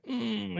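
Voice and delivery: high, soft groggy stretch hum; sleepy waking hum. Retold or imitated. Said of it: "when you're waking up and you're kinda like".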